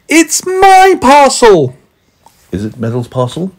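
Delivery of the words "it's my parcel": high, loud, stretched-out yell; shouting back. A narrator's reading. Meddle shouting back across the stream, shouting. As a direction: shout it slowly, insisting on ownership. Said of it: "and shouted back".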